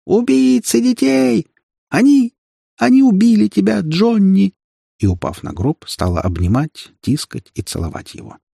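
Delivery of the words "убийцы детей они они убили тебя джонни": very high, anguished shriek; thin-voiced scream. A narrator's reading. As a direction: scream in grief, high and loud. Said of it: "закричала тонким голосом, срываясь на визг". (тонкий крик на грани визга)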